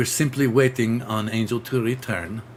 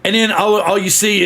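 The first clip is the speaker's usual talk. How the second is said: ordinary delivery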